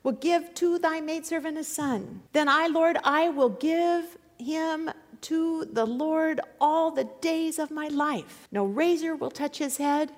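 high, fervent prayer of a desperate woman. Acted. Hannah praying for a son at the temple, high and fervent. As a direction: cry out to God, begging for a son. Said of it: "And she said".

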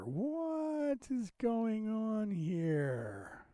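high, very slow, soft wondering; drawn-out bafflement. Real, not acted. Host to himself as the player fails, baffled. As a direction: stretch it out high in puzzlement.

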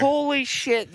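high-pitched burst of excitement; excited exclamation. Real, not acted. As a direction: blurt it out, way up high. Excited reaction to magic hats.

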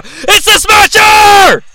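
high, slow, drawn-out announcer shout; excited shout. Real, not acted. Hyping the Smasher's entrance, announcer shout. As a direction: belt out the entrant's name.

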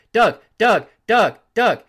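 high, loud repeated calls, imitating pestering fans; incessant yelling. Imitating fans pestering him while he eats, insistent yelling. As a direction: yell the name over and over. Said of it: "people will start yelling his name incessantly".

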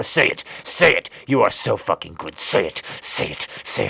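very loud commanding shout. Acted. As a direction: bark the order.

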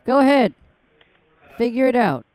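taunting dare. Real, not acted. Taunting a scammer on the call.

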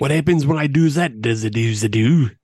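hammed-up fake British accent. Showing off for doubtful co-hosts, mock British. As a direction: play up a dubious British accent. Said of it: "my British accent".

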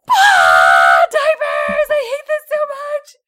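high loud frustrated shout; frustrated yell. Retold or imitated. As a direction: scream it in exasperation. Retold frustrated outburst.

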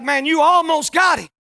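loud admiring yell; cheering shout. Retold or imitated. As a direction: shout it like a proud buddy. Buddies praising the rider.

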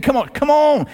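high-pitched, urging call; eager beckoning. Retold or imitated. Jesus urges Peter onto the water, eager high call. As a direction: coax someone over with rising eagerness.

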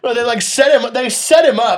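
high, loud incredulous outburst; almost yelling in disbelief; excited. Real, not acted. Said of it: "excited outrage at the story".

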